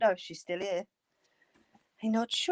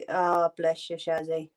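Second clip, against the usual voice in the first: normal talking voice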